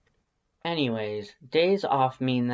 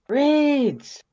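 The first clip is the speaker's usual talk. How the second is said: a high, loud call from another room; 8 dB louder than usual. Acted. Undyne calling for Reeds through the house, loud. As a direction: call the name across the house.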